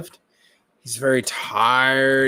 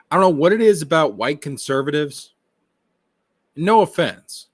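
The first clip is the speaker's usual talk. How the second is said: ordinary delivery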